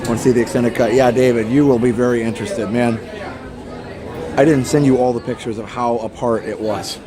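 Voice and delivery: ordinary talk, nothing marked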